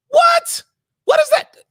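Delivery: high incredulous what; can't believe what he just heard; shocked disbelief. Real, not acted. Reacting to the woman's clip with shocked disbelief.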